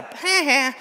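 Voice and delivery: a snotty cartoon kid jeering; Bart Simpson ha ha. Speaker mimics a cartoon kid's taunt, mocking. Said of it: "That's like a Bart Simpson".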